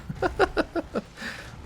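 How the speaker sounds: breathy high squeal of a laugh; high squeaky laugh. Real, not acted. Streamer cracking up at chat, high squeaky laugh. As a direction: burst into a high unvoiced giggle.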